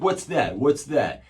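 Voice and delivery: loud, high mock-officer challenge; posturing cop. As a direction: imitate a cop squaring up aggressively. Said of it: "he's like, posturing".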